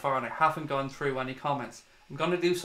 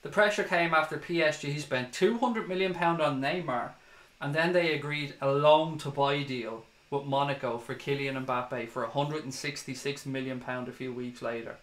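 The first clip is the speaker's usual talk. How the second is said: ordinary talk, nothing marked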